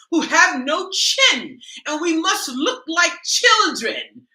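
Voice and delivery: high, loud, slow British makeup-tutorial parody; mock British lady preaching contour, slow and high; mock British accent. Satirical YouTuber teaching ladies to contour, mock British accent. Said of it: "talking in, like, a British accent".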